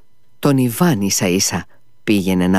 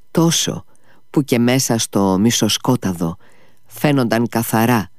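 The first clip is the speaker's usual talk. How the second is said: plain reading voice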